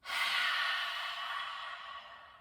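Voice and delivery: long loud breathy haa, tongue out; lion's breath exhale. Shown as a demonstration. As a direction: push all the air out in a roaring exhale. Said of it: "exhale for lion's breath".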